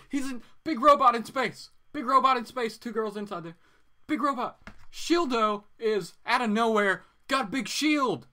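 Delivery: high and loud, tumbling over with enthusiasm; giddy fan recounting the scene; excited fan gush. Real, not acted. Gushing about favorite anime scene.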